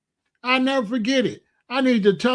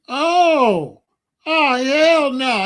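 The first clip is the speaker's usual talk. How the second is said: shocked dismay; 6 semitones higher, 5 dB louder, much slower than usual. Retold or imitated. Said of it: "reacting to son".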